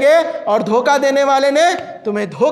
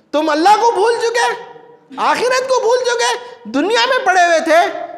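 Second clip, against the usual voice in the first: high-pitched, ringing reproach; acted rebuke; 8 semitones higher than usual. The answer reproaching hypocrites who forgot God, acted rebuke. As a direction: throw the accusation out in a raised pitch.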